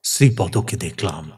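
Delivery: low, reluctant confession; hesitant admission. Acted. Elder brother admits what he saw, hesitant and low. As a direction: admit it haltingly, voice dropped. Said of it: "একটু ইতস্তত করে বললেন".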